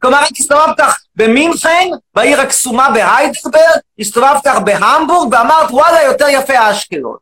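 shouting, indignant rant at the audience; loud mock rant. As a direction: bellow it like an outraged demagogue. Loud persona.

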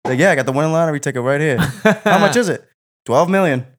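high, loud mock back-and-forth; bantering movie characters. Friend quotes favorite bar lines, loud hammed-up banter.

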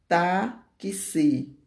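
"tá que se" slowly, pausing after "tá"; drawn-out, split "tá que se"; syllable by syllable. Shown as a demonstration. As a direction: sound out "tá que se" in two pieces. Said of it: "Duas sílabas".

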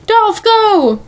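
high-pitched, drawn-out "go"; urgent mock call. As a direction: cry out for a getaway. Retold getaway shout from the car.

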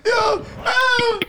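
high squeal of a laugh through the words; asking while losing it laughing. Real, not acted. Streamer cracking up at chat.

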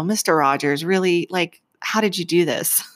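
usual speaking voice

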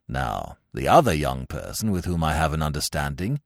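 low, measured, very formal butler; imperturbable valet. Acted. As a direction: confess it discreetly in a deep, composed voice. Jeeves calmly confesses.

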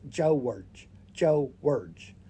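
George drawn out in two syllables, Jaw-erge, falling. Retold or imitated. Correcting a friend, George in two syllables, falling. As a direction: stretch George into two beats, falling at the end. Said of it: "It's two syllables".

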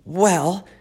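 high, whiny piglet voice; a disappointed young pig. Acted. Wilbur explains.

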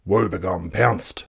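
ordinary delivery

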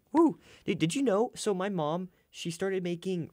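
woo, can't wait to tell this; excited. Real, not acted. Bursting to share news.

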